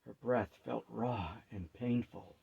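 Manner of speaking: ordinary delivery, nothing marked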